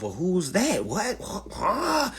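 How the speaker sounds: confused asking. Retold or imitated. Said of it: "I know you're asking yourself".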